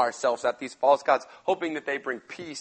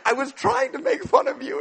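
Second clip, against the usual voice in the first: much higher, comic disbelief; exasperated squeak; 4 dB louder than usual. Retold or imitated. Elijah protests to the yelling prophets, high exasperated squeak.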